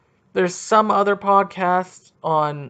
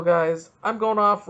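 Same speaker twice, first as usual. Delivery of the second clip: ordinary talk, nothing marked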